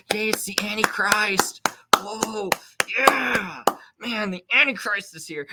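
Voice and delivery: high, hammed-up gushing fan voice; mock cheering fan. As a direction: mimic a giddy crowd cheering the Antichrist. Mocking cheering crowd.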